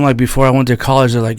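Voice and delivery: usual speaking voice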